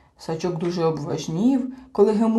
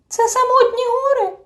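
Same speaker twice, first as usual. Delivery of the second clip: small creature's question; 6 dB louder than usual. A narrator's reading. Tiny creature asks high-voiced.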